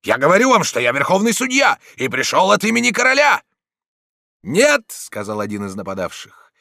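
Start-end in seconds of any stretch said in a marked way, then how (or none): 0.0-3.4 high, commanding yell; insistent loud shout. A narrator's reading. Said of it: "громко закричал Дон Иниго". 4.4-4.8 high, loud refusal; defiant shout. A narrator's reading. Attacker defies the judge.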